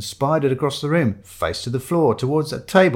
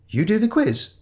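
high-pitched surprised question; incredulous; 10 semitones higher than usual. Acted. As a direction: echo it back in disbelief. Narrator astonished at the inspector.

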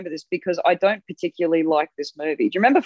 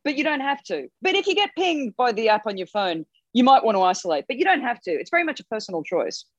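imitating Boris Johnson; 7 semitones higher than usual. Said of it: "And he went".

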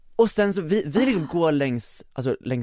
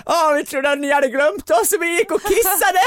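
high, giddy babbling into a mic; re-enacted giddy outburst; goofing around; 13 semitones higher, 4 dB louder than usual. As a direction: blurt it out in a silly high voice. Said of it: "börjar jag flamsa".

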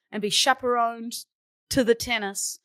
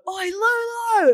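shout; 11 semitones higher, 5 dB louder, slower than usual. Retold or imitated. Strangers calling from the street.

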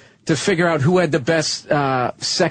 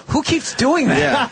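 higher, slow, laughing "who keeps doing that"; annoyed bystanders; 7 semitones higher, much slower than usual. Retold or imitated. Comedian mimics the shushed crowd, slow and laughing. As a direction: play the puzzled crowd, half laughing. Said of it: "people start going".

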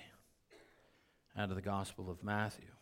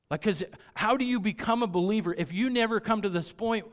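higher, louder preaching push; 9 semitones higher, 9 dB louder than usual. Acted. Pastor urging believers about evangelism, fervent preaching. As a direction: drive the point home.

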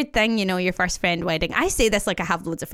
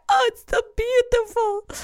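higher-pitched, slowed, hammed-up Italian accent; slower than usual. Onlooker woman gushing at the proposal, mock Italian accent. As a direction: gush it like a moved Italian stranger, with an Italian accent. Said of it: "That's supposed to be an Italian accent".

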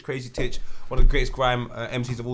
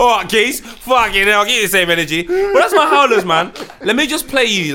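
loud; 7 semitones higher, 9 dB louder than usual. Real, not acted. Loud energetic podcast opener.

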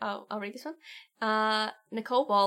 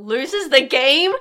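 dramatic; 4 semitones higher, 10 dB louder, slower than usual. Acted. Dramatic card reading.

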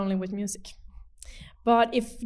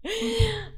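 high laugh; 12 semitones higher than usual. Real, not acted. Laughing after thanking guest.

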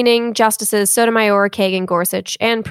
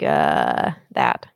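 a short creaky demo of vocal fry. Shown as a demonstration. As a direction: drop low into a creaky fry on the uh. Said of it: "Or is fry the".

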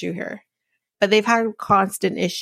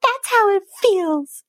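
much higher, mock whiny imitation of the bride; mocking her voice; 12 semitones higher, slower than usual. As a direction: parrot her line in a high mocking voice. Mocking the bride's quoted reply.

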